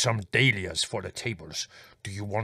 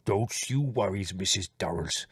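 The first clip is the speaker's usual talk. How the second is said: comforting Spiro; 5 dB quieter than usual. A narrator's reading. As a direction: soothe her gently in a foreign accent. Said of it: "said Spiro comfortingly".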